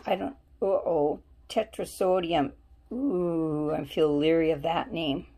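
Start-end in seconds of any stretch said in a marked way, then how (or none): none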